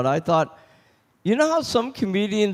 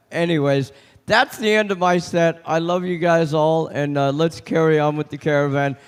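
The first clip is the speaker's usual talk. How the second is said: usual speaking voice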